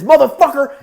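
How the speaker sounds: loud, high burst; acted furious outburst; angry yell. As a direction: spit out the curse at full voice. Acted yell before the punch.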